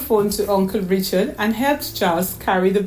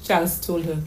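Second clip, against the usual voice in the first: usual speaking voice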